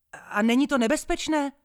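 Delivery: high, loud worried call; calling after. Acted. Man calling after the departing boy, loud. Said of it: "zavolal na mě ještě muž".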